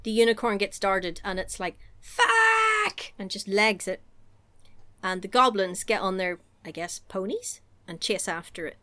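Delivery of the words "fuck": high, drawn-out yelp; mock cry of alarm. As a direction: cry out like a darted animal. Unicorn darted, cries out.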